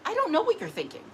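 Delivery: high-pitched, incredulous acted reply; exasperated comeback. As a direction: protest it, voice climbing high. Acted exasperated answer to questioner.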